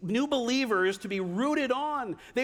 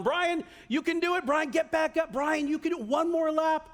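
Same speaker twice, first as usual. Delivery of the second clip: cheering grandma; 7 semitones higher, faster than usual. Acted. Late grandma in the second row to her grandson, cheering him on. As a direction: imitate a grandma urging on her runner. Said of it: "cheering me on".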